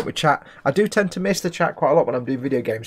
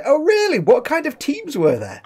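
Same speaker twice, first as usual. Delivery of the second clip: mock curious; 14 semitones higher, 5 dB louder than usual. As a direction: play it all innocent and curious. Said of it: "I'll say".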